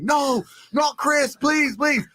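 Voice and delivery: high, slow, panicked begging; mock panic. Client panicking on camera over his gummy bear, high frantic plea. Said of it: "like, panicking".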